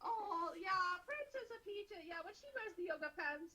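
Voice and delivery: high, sobbing cartoon Italian voice; mock Italian accent. Answering the bit about Princess Peach. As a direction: whimper it in a bad Italian accent. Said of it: "What the fuck kind of accent is that?".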